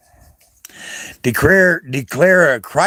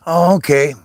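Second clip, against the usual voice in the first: ordinary talk, nothing marked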